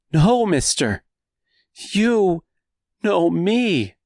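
high, desperate begging; pleading voice. Acted. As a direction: plead with him, cracking. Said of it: "the same pleading voice".

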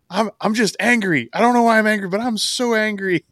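high-pitched, hammed-up angry whine; mock angry. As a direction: imitate a wrestler whining that he's angry, pitched well up.

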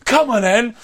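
high, loud mock drunk challenge; aggressive challenge. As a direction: imitate a drunk squaring up for a fight. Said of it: "really aggressive".

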